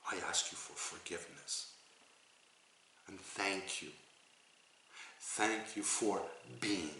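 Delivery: soft, measured prayer; hushed gratitude. Shown as a demonstration. Teacher models asking forgiveness and giving thanks, hushed and sincere. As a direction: say it softly, with unhurried pauses.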